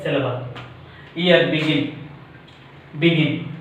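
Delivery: ordinary talk, nothing marked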